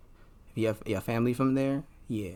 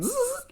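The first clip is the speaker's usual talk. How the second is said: rewind noise. Retold or imitated. Vlogger showing her mind rewinding like film, high squeak. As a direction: mimic a tape zipping backwards. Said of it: "I'm like".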